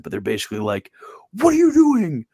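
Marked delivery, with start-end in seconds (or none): 1.3-2.2 much higher, louder hammed-up British accent; mock British accent. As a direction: squeal it like an outraged British right-winger. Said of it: "I can't do the British accent".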